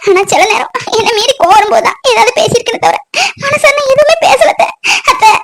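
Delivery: high, tearful wailing; weeping over the fallen one; crying. Acted. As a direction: plead through sobs. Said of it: "அழாத பவித்ரா".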